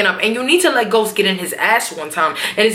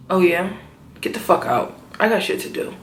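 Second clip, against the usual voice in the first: soft, blank, low Tommy voice; mock cold Tommy; 5 semitones lower, 5 dB quieter than usual. Tommy dismissing Tasha from the car, soft and cold. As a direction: say it dead flat and quiet.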